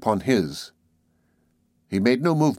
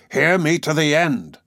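firm voice; 5 semitones higher than usual. A narrator's reading. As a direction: demand to be heard out. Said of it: "with a firm voice".

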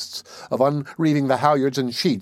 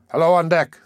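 calling up; 6 semitones higher, 5 dB louder than usual. A narrator's reading. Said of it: "I replied".

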